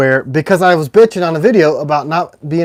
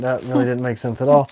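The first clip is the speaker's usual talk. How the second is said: usual speaking voice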